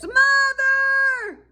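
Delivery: high, loud yell; calling Mother. Acted. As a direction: shout for Mother across the house.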